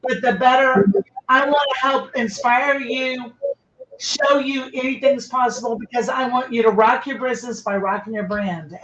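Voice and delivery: normal talking voice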